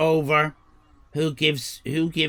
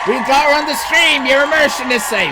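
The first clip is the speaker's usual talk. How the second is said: excited shout; 7 semitones higher, 6 dB louder, faster than usual. Real, not acted. Excited announcing guest on stream.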